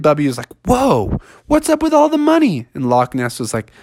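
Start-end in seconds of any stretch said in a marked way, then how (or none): 0.6-2.7 high, wondering bear; amazed bear. Acted. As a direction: gape at the coins.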